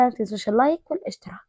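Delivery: fast whispered reminder; a quick breathless whisper. Real, not acted.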